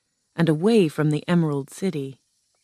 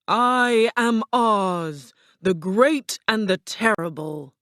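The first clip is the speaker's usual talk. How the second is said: slow, grand booming wizard; roared; 4 semitones higher, slower than usual. A narrator's reading. Ball of fire announces himself to the lion, slow roar. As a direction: draw it out as a mighty roared proclamation. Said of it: "the ball of fire roared".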